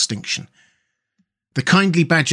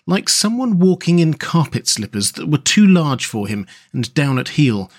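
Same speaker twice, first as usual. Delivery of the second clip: plain reading voice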